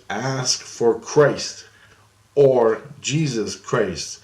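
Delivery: slow, deliberate, word by word; big written words. Retold or imitated. Writing a note for the little girl, slow and deliberate. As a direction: spell out the message very slowly. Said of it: "I write real big words".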